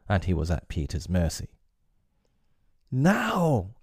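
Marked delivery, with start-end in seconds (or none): 2.9-3.8 put-on high, eager cry of a crowd of boys; boys' cry. The boys urge Peter to finish Hook, crying out high. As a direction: urge the winner on. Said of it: "cried all the boys".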